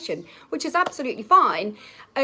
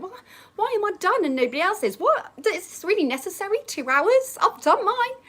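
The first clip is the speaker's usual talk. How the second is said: much higher and fast, incredulous; baffled disbelief; 10 semitones higher than usual. Retold or imitated. Schoolgirl in exam baffled at finishing early, high and fast. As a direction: squeak it out, astonished the others aren't done.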